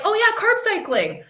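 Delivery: high-pitched imitation of a keen dieter; mock eager follower. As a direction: parody someone jumping on a diet trend. Mimicking an eager follower's comment.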